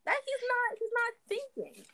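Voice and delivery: high-pitched disbelief; exasperated. Real, not acted. Exasperated at villain's plan.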